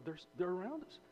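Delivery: high, frantic "what are we gonna do"; panicked servant boy. Retold or imitated. Servant boy panicking to Elisha about the surrounding army, high and frantic. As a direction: voice shooting up in alarm as the frightened boy. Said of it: "he was in a full twit".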